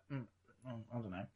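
very low, soft sleepy mumble; half-asleep. Retold or imitated. Woken at night by a friend's rambling call, half-asleep mumble. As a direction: imitate someone barely awake on the phone. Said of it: "I was just asleep".

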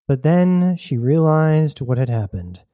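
plain reading voice

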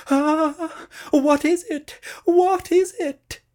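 frightened high squeal; startled cry. A narrator's reading. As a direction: gasp it out in fright. Startled sheep cries out.

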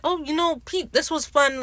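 retold breezy sign-off, pitched high; lightly acted cheerful aside, high voice. Lucius tells Pete it was fun, light and high.

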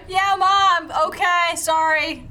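high, loud, exasperated apology to mom; daughter yelling back. Acted. Daughter apologising to her mom over the shoes, yelled back. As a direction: holler back to your mother, drawn out.

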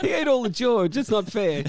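laughing whine. Pretend. Playing cannibal sumo wrestlers.

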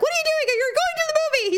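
high, exasperated mock yell; retold scolding. Imitating herself telling Daryl off, high exasperation.

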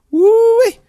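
high excited woo-wee; a loud impressed whoop. Retold or imitated. Everyone reacting to haircut.

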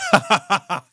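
high, loud rising chuckle; another chuckle. Acted. Nolan answering Sarah's praise, a high chuckle. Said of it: "Nolan kind of lets out another chuckle".

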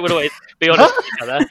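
barely holding in the laugh. Real, not acted. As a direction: crack up while quoting the pledge. Laughs while voicing childhood pledge.

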